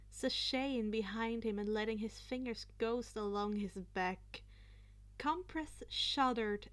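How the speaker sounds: ordinary delivery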